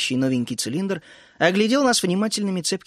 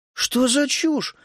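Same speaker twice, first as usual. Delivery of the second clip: выкрикнули хором; 9 semitones higher, much slower than usual. A narrator's reading. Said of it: "хором выкрикнули мы".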